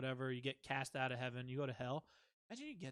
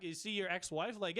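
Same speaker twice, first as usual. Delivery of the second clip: usual speaking voice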